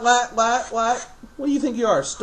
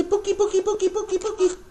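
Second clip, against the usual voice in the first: much higher, sing-song Pookie Pookie for the bird to copy; high bird-teaching voice; 13 semitones higher, faster than usual. Shown as a demonstration. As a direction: coax the parrot to repeat it, way up high.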